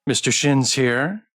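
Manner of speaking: ordinary delivery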